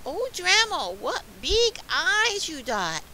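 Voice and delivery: put-on high, loud little-girl wonder; slightly scared storybook girl. A narrator's reading. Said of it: "she's kinda scared a little".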